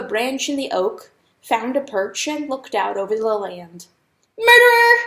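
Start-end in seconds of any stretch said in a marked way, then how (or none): 4.4-5.0 high, loud shriek; a mouse shrieking murderer. A narrator's reading. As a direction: shriek the accusation at full force. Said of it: "the mouse shrieked again".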